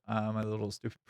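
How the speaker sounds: normal talking voice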